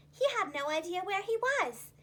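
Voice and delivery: ordinary delivery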